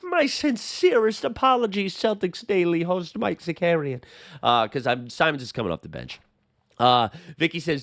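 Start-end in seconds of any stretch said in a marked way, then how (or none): 0.0-4.0 high, slow, simpering fake sorry; hammed-up apology in a squeaky put-on voice; mock groveling apology. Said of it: "you're gonna be the one in the comments saying".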